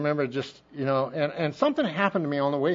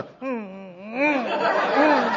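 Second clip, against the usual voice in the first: imitated engine rev; 5 semitones higher, much slower than usual. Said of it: "spin gravel and go".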